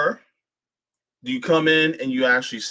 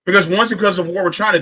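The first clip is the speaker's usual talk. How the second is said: ordinary talk, nothing marked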